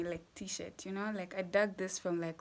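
usual speaking voice

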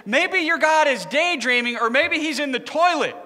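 high, punched-up mocking taunt; making fun. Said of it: "he starts to make fun of them".

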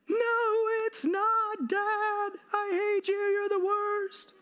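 put-on high, upset little girl; high bratty kid snapping at dad; whiny child voice. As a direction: pitch way up like a furious small daughter. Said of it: "daughter answers on the ride".

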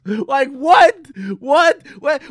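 shouted what, pitch jumping up; loud disbelief. Real, not acted. Reacting to a billion-dollar prediction, shouted disbelief. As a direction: cry out in disbelief.

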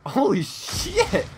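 slow, high, laughing holy shit; stunned laughing shout. Real, not acted. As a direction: laugh it out in disbelief. Stunned by a wild play.

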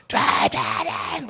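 breathless then very high frantic cry; panicked yelling. Retold or imitated. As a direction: scream at the driver to go. Yelling at getaway driver.

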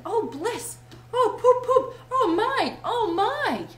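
high, loud ecstatic gushing, building; rapturous raving. A narrator's reading. Toad raves about the poetry of motion, rapturous.

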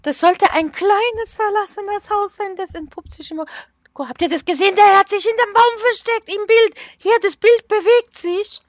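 high and loud, rattled; agitated and spooked. Real, not acted. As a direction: blurt it out, unnerved. Bild bewegt sich plötzlich. (aufgeregt und erschrocken)

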